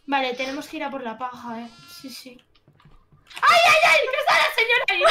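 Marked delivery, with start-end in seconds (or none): 3.4-5.0 panicked high-pitched scream; screaming in fright. Real, not acted. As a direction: scream out, truly scared. Scared by a monster in a game.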